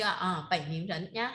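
"Bảy Ninh Rấn" said slowly and clearly for the class; teacher models "Bảy Ninh Rấn" syllable by syllable; slow model pronunciation. Shown as a demonstration. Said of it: "Đúng rồi, Bảy Ninh Rấn".

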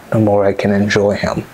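ordinary talk, nothing marked

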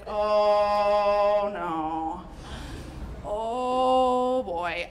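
low, long drawn-out groan; deep, held moan of dismay; sinking dismay. Retold or imitated. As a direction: stretch each oh in slow-dawning dread. Horrified reaction to the voiceover.

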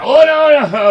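a high, loud, panicked no to stop him getting mad; panicked protest. Acted. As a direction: back away in panic. Big D gets angry.

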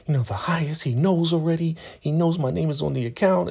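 scared high-pitched imitation; mock panic in a put-on high voice; Joan. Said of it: "she is so scared now".

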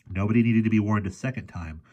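ordinary delivery, nothing marked

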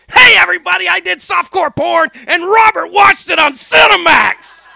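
high, loud, proud shout; loud announcement. Retold or imitated. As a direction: announce it to the whole table. Announcing at dinner table.

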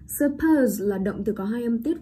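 ordinary talk, nothing marked

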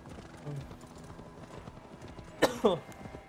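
soft breathy coughs; quiet cough clearing the throat after choking; coughing up water. Real, not acted. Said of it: "Water went down the wrong tube".

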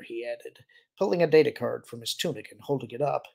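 ordinary delivery

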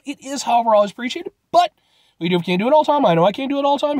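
ordinary talk, nothing marked

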